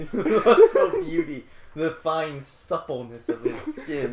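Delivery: slow, hammed-up director impression with laughter; high, loud start easing into slow mock reverence; mock-rapturous auteur. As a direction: savour each word in a put-on voice. Hams up the Herzog phrase.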